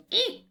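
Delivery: high-pitched little squeak; cartoonish high squeak; imitated eek. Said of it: "it goes".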